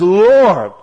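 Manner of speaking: loud, drawn-out call to God; crying out. Acted. Asa calling on the Lord in trouble, crying out. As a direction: call out to heaven from the pulpit. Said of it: "He cries out to the Lord".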